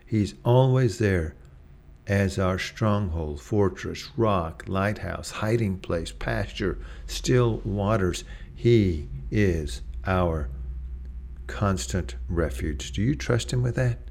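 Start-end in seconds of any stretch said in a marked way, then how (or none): 2.1-12.9 slow litany. Acted. Closing litany, slowed.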